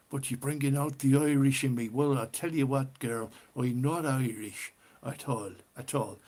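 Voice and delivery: playful put-on Irish accent. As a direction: tease her in a stage Irish brogue. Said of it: "I can do the Irish accent".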